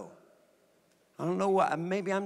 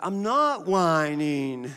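slow, drawn-out mock whine; child's whining; much slower than usual. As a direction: imitate a five-year-old whining in denial. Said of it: "There it is, right there".

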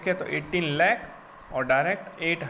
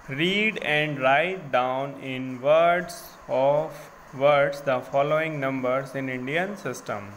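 slow dictation read; 4 dB louder, much slower than usual. Shown as a demonstration. Teacher reads the book question to the class, very slow and deliberate. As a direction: read the question out word by word, very slowly and a bit louder.

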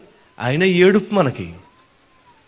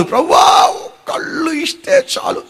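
high shouted slow plea; crying out; 10 semitones higher, slower than usual. Acted. Blind man shouting to Jesus, high plea. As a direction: cry out to the Lord.

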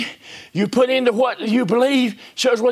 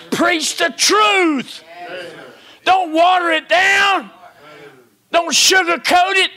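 much higher, louder, slow shouted charge; full-force preaching; 9 semitones higher, 4 dB louder, slower than usual. Acted. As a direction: thunder it at the congregation.

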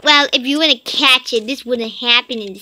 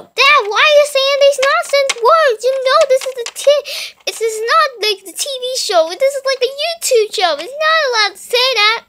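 much higher, louder scolding; indignant little kid; 11 semitones higher, 4 dB louder than usual. Acted. Son scolding dad for swearing, high indignant. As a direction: tell dad off like an outraged small child.

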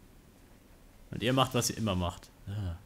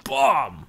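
punching sound; 5 semitones higher, 8 dB louder than usual. Acted.

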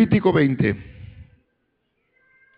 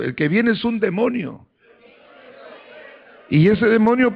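raised-pitch, forceful sermon delivery; fired-up preaching; 8 semitones higher than usual. Acted. Preacher warning the church about mediums and calling for praise, fired-up preaching. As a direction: preach it out to rouse the congregation.

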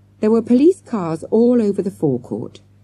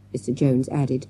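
usual speaking voice

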